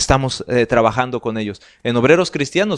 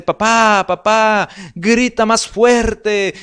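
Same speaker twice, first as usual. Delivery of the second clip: put-on higher, louder child's plea, slowing on the last words; child calling dad; 8 semitones higher, 5 dB louder, much slower than usual. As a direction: call out to papá like a pleading child. Child pleading with father in saying.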